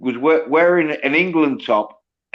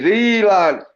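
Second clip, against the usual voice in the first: higher-pitched broad Yorkshire catchphrase; Yorkshire accent; 7 semitones higher than usual. Shown as a demonstration. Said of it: "a Yorkshire accent is".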